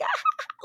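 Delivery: squealing high giggle; a quick giggle, very high; high laugh. Retold or imitated. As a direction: burst into a high-pitched giggle. Mimics her own laughing on stairs.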